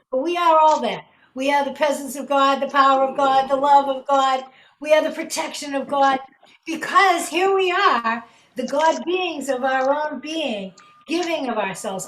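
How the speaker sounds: rousing the group before the prayer; preaching affirmation. Real, not acted. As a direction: proclaim it with conviction. Affirms God's presence.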